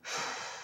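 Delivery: quiet, voiceless exhale; soft sigh. Real, not acted. Sighs while wrapping up.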